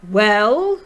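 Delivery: loud, rising demand; pointed, questioning boom; expectant prompt. A narrator's reading. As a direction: demand an answer with an arched eyebrow. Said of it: "asked the general, generalissimo, arching his eyebrow".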